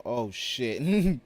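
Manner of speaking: loud startled curse; surprised. Real, not acted. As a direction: caught off guard, loud. Surprise in game.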